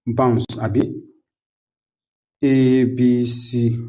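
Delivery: ordinary delivery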